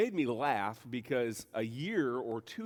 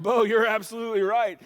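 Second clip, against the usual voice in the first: high, loud comic agreement; emphatic agreement; 11 semitones higher, 12 dB louder than usual. Retold or imitated. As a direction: re-enact wholeheartedly agreeing with a friend.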